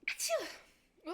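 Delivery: big high sneeze, let it burst out; sudden real sneeze; loud real sneeze. Real, not acted. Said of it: "Wait, I have to sneeze".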